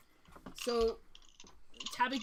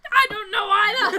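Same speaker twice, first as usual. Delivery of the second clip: very loud, higher dwarf cry; uproarious dwarf admission; 11 semitones higher, 15 dB louder than usual. Acted. Dwarf admitting the same to his brother, uproarious. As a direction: bellow it as a comic confession.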